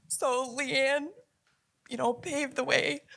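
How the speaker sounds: fighting tears while praising her; choked up. Real, not acted. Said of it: "I'm getting a little emotional".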